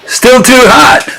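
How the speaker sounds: ordinary delivery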